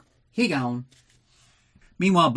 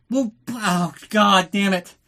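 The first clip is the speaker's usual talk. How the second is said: higher, hammed-up sulky curse; mock grumbling curse; 7 semitones higher, 4 dB louder, slower than usual. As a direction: curse it like a sulking child. Columbo grumbles, boat won't turn.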